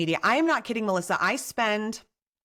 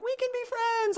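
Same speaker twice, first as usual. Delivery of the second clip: delighted squeal. Retold or imitated. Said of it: "And I'm like".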